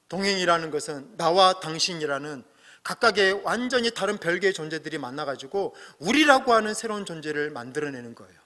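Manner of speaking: ordinary talk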